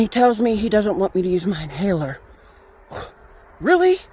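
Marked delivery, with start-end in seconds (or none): 3.6-4.0 high, loud incredulous question. Retold or imitated. Told by twin flame to skip the inhaler, incredulous. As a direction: jump up in pitch with disbelief.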